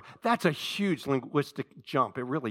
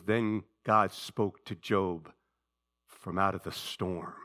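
slow scripture read; 8 semitones lower, slower than usual. Shown as a demonstration. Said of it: "slow reading of scripture".

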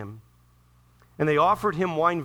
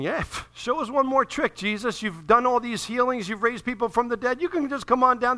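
jeering mob taunting Jesus; mocking crowd; 8 semitones higher, 4 dB louder than usual. Crowd jeering at Jesus on the cross, mocking. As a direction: sneer it up at him like the crowd. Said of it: "They're mocking him from below".

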